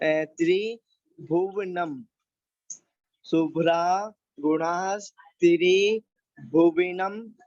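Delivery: chanting the Sanskrit line slowly; slow verse recitation. Shown as a demonstration.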